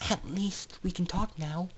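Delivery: soft hushed whisper. Acted. Aurora whispering to the foals in the dark carriage. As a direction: hush it down in the dark. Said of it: "Aurora whispered in the dark".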